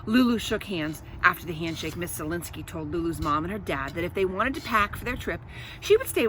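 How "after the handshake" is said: plain reading voice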